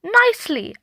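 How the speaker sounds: put-on high little girl echo; toddler echo. A narrator's reading. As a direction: copy Mum in a bright small-child voice. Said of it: "agreed Ruby".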